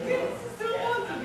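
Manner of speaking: excited. Retold or imitated. Kids thrilled at tie-dye.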